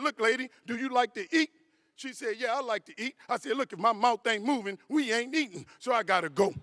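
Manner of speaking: loud, punchy husband arguing his case; exasperated comeback. Retold or imitated. Husband telling his wife he has to go, exasperated comeback.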